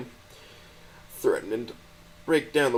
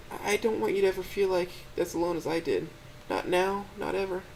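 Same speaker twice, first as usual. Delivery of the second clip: put-on a crying girlfriend pleading to help; high, gentle, tearful voice; tearful Stella; 8 semitones higher than usual. A narrator's reading. Stella's voice in the story.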